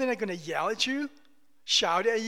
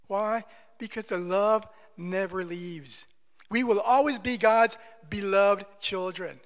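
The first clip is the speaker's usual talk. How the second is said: ordinary talk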